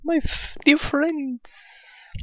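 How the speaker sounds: very high, cartoonish English greeting; high silly greeting voice. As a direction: squeak it like a cartoon host. (hohe alberne Begrüßungsstimme)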